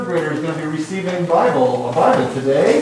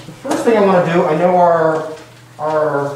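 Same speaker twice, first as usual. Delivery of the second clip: normal talking voice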